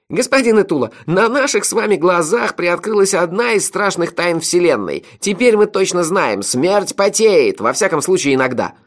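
high, loud mock-solemn proclamation; inspired clowning. A narrator's reading. Melifaro plays up to the innkeeper, inspired clowning. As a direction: declaim a terrible secret theatrically, drawing out the key words. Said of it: "вдохновенно кривлялся и размахивал руками".

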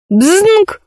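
high, ringing "bzyng"; sharp high-pitched impact noise; imitated metallic clang. A narrator's reading. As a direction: voice the stone pinging off metal. Imitated noise of stone strike.

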